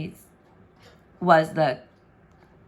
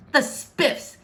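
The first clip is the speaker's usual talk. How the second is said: venomous; 6 semitones higher than usual. Acted. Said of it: "he spat out in a venomous voice".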